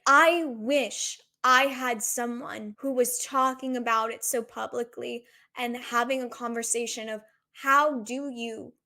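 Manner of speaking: usual speaking voice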